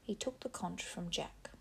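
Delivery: usual speaking voice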